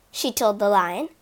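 usual speaking voice

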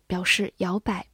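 ordinary delivery

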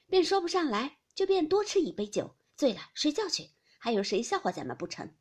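jolly her along, lightly acted; lightly voiced reassuring lady. A narrator's reading. Lady brushing off fears of being laughed at, lightly voiced cheerful reassurance.

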